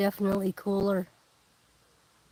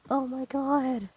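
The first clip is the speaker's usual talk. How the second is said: awed; 4 semitones higher, slower than usual. Real, not acted. Gasp at eclipse.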